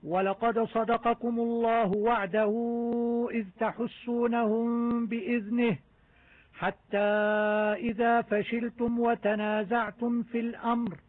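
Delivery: slow chanted recitation, every letter clearly sounded; Quran recitation. Shown as a demonstration. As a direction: recite the verse, sounding each izhar clearly. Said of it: "جميع أنواع الإظهارات". (تلاوة مجودة بإظهار واضح)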